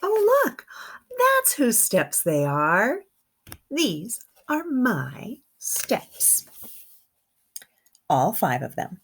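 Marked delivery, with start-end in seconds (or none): 0.0-3.0 delighted gasp of surprise; excited discovery. Real, not acted. 3.7-6.5 put-on slow, sing-song reading to children; slow read-aloud. A narrator's reading.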